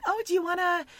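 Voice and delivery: put-on high, drawn-out kid invitation; eager kids. Kids invite the new girlfriend to play downstairs, imitated eagerness. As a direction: imitate children asking a guest to play. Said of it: "the kids are like".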